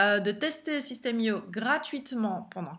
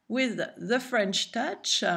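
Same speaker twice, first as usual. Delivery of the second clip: put-on slow English with a heavy French accent; much slower than usual. Vlogger joking about her French touch, French-accented English. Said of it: "Désolée pour mon accent".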